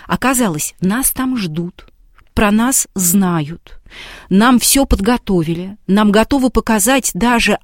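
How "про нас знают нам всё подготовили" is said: ordinary talk, nothing marked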